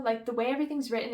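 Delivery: usual speaking voice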